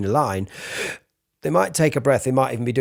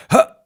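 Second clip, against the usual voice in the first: tight, strained huh on held breath; squeezed huh; 9 dB louder than usual. Shown as a demonstration. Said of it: "when you hear that sound I just made".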